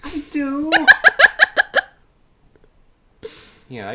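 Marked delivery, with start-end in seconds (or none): 0.8-1.8 shrieking laugh. Real, not acted.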